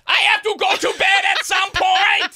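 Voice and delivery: high, loud, shouted mock German accent; mock German caller. Caller phoning cops about neighbours, shouted mock German. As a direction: bark it out like an excited German caller.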